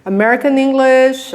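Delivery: ordinary talk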